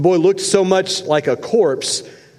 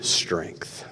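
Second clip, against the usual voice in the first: whisper; 10 semitones lower, 11 dB quieter than usual. Real, not acted. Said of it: "whispered line".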